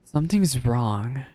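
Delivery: ordinary delivery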